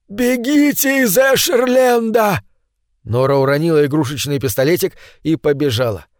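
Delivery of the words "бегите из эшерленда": put-on high, slow desperate old man cry; desperate shout. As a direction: cry out with all he has left. Old man cries out to flee.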